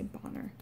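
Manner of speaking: "bonner" quietly, AH vowel for banner; low-key Irish "bonner". Shown as a demonstration. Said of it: "with the Irish accent".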